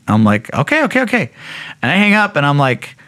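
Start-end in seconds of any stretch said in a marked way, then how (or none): 0.5-1.3 quick, high, rattled okays; rattled and eager to get off the phone; high-pitched nervous agreement; alarmed okays. Retold or imitated. Urgent call, told to rush home.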